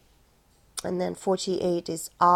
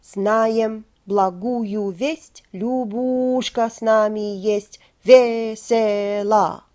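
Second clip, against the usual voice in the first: slow, steady, rhythmic reading of Знаем благую весть; в ритме; 3 dB louder, slower than usual. Shown as a demonstration. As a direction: speak it in the song's rhythm, slowly. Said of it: "And in rhythm".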